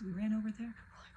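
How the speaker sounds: usual speaking voice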